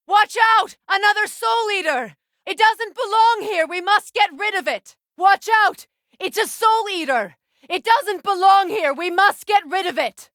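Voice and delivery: high, loud alarmed shouts; urgent battle cry of alarm; shouted warning. Acted. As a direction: yell a warning to the others. Warning shouts about Soul Eater.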